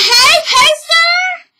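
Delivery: high, drawn-out shout in imitation of Kay; excited dog greeting. Kay greets the returning dog Pacer, calling out high and loud. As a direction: call out long and high to a beloved dog.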